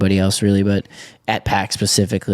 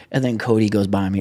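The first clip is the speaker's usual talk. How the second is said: usual speaking voice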